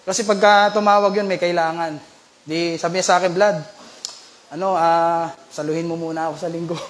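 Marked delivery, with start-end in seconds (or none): 3.4-6.8 lower, softer voice of an ailing pastor; lightly voiced unwell pastor asking a favour; imitating sick pastor. Ailing pastor asks speaker to cover Sunday, lightly imitated, low and weak. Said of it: "iba yung boses niya eh".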